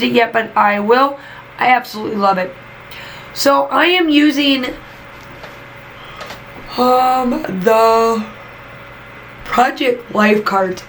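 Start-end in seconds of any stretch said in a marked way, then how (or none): 3.3-10.8 talking through a yawn; words stretched by a yawn. Real, not acted. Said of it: "I am so sorry for the yawning".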